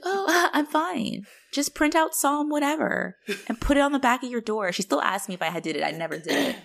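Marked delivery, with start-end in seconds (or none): 0.0-4.7 high, airy mom voice; oblivious mom. Retold or imitated. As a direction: imitate mom brushing it off, pitched way up. Voices mom's oblivious reassurance.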